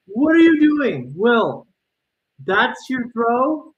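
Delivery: very high, loud yell at the player; exasperated yell. Real, not acted. Streamer yelling at Will for his throw, loud exasperated shout. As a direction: scold the player through the screen.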